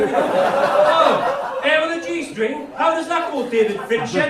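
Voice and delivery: a loud, high, hammed-up baffled viewer; mock puzzled deaf viewer. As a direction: ham up a confused viewer asking. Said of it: "every single deaf person ... making it up".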